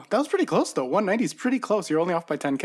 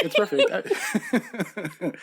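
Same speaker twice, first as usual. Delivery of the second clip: laughing; much slower than usual. Real, not acted. Laughing at his own timing.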